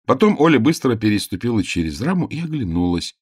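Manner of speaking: ordinary delivery, nothing marked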